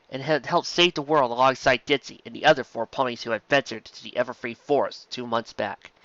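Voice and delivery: ordinary delivery, nothing marked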